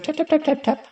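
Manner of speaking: high tapping sound imitation; stick tapping. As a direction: tap tap out like a cane on pavement.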